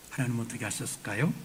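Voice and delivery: usual speaking voice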